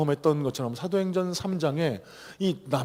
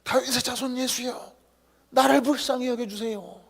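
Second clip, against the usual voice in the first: higher, straining plea to Jesus; crying out louder; 10 semitones higher than usual. Retold or imitated. Blind beggar refusing to be silenced by the disciples, louder cry. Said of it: "더 크게 소리 지릅니다".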